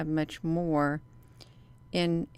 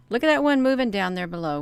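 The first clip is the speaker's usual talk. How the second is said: excited; 4 semitones higher, 9 dB louder, faster than usual. Real, not acted. Spots a ship on screen.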